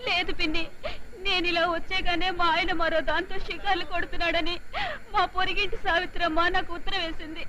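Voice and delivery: slow, weepy lament; crying as you confide; tearful. Acted. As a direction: sob out the bad news to an aunt. Wife laments husband's reported affair.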